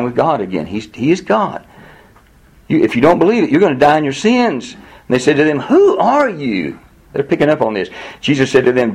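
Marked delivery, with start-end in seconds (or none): 5.6-6.8 high, slow, drawn-out demand; incredulous challenge. Retold or imitated. The crowd challenges Jesus, high and drawn-out. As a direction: stretch it out as a sceptical question.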